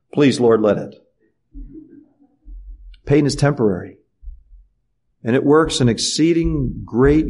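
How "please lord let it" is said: ordinary delivery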